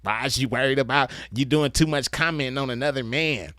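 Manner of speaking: mock the objection, dragging the last words; mocking complainer. Man mimics what grown men don't say, mocking complaint. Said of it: "grown-ass men don't say shit like".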